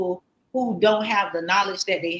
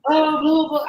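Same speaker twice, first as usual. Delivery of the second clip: dramatic; 7 semitones higher, 5 dB louder than usual. Retold or imitated. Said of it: "not gonna be like".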